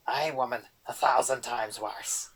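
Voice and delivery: loud goblin brogue, ranting; angry boggart. A narrator's reading. Boggart rants at Erder about the cream, angry goblin brogue. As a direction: scold her like a cranky goblin.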